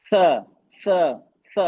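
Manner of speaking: isolated स, emphatic and separated by pauses; tongue-contact sound. Shown as a demonstration. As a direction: demonstrate स with the tongue, one falling syllable each time. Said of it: "जबान से contact हो रहा है".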